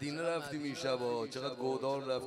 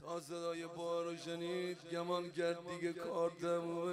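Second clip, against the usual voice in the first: quiet grieving chant; hushed lament; 7 dB quieter than usual. Acted. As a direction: draw the mourners in quietly.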